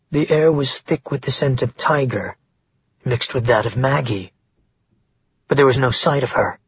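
plain reading voice

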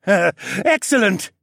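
slow, high evil-genius gloat; grinning relish. A narrator's reading. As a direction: draw it out with a wicked grin. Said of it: "he grinned".

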